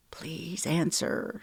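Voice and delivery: soft, slow, barely-there plea; silent plea. Acted. Ava pleading while dialing Luna, hushed and slow. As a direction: pray under your breath that she picks up. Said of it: "she silently pled".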